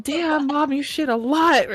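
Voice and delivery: loud, high blurt at mom; mock astonishment. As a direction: exclaim it in amused disbelief. Imagined astonished remark to mom.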